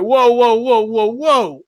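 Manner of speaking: loud, high string of whoas; hammed-up loud interruption; mock alarmed halt. Acted mayor halting someone with accent.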